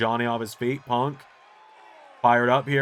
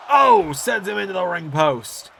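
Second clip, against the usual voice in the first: loud, high excited shout; 7 semitones higher, 5 dB louder than usual. Real, not acted. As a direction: blurt out the big spot. Commentator calls big move.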